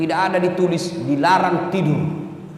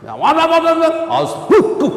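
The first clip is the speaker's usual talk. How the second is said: high loud mock blustering; husband ranting; 10 semitones higher, 4 dB louder than usual. Husband raging back at his praying wife, mock blustering. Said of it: "Naik suaranya suami".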